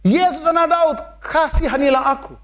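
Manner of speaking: high pleading cry. A narrator's reading. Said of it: "Dia bisa teriak out of rejection".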